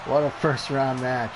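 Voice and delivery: lively ringside wrestling call; energetic play-by-play over a brawl; play-by-play commentary. Acted. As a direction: call the match like a TV announcer. Live wrestling game play-by-play.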